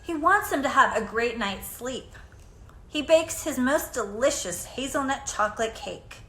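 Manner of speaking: ordinary delivery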